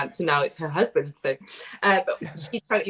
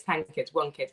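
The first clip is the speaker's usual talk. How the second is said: normal talking voice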